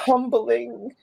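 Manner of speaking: high, drawn-out mock groan of the word; mock dismay. Dancer reacting to being cast as tumbler, high mock dismay. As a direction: squeal the word in comic dismay. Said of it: "And I was like".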